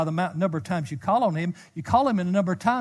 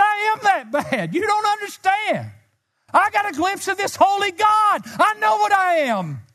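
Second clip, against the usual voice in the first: impassioned protest; 6 dB louder than usual. Retold or imitated. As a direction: cry it out in desperate insistence, far higher. Preacher relives his impassioned protest.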